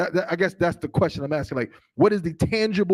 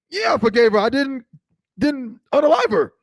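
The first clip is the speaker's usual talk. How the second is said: higher, emphatic mock reply; worked-up self-justifying; 7 semitones higher, 6 dB louder than usual. Man answering whether he forgave her, worked-up and defensive. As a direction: protest it as an acted-out answer. Acting out indignant answer to imagined question.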